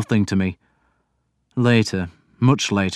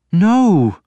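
sharp protest; 11 semitones higher, 7 dB louder than usual. A narrator's reading.

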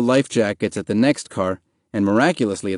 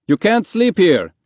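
high, loud police call-out; loudspeaker order; 9 semitones higher, 5 dB louder than usual. A narrator's reading. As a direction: order them off as if over a loudspeaker. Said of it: "told them through a loudspeaker".

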